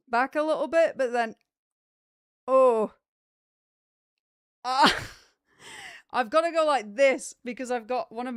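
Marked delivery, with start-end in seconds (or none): 2.5-4.9 real pain while stretching; sharp gasps of pain, louder; pained groan. Real, not acted. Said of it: "it hurts quite a bit at the minute".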